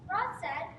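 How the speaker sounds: usual speaking voice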